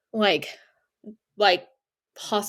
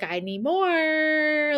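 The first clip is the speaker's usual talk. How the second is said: high, long drawn-out eerie moan; horror-film voice; 6 semitones higher, much slower than usual. Retold or imitated. Drugged patient begging for more drugs, horror-film moan. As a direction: stretch the last word out like a movie ghost. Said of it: "talking like something out of, like, a horror film".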